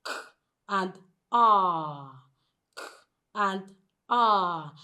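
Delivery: bare "k", then a long "ah"; sounded out. Shown as a demonstration. Teacher breaks car into two sounds, sounded out. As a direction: split into separate "k" and "ah" with pauses. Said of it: "The word car is just two sounds".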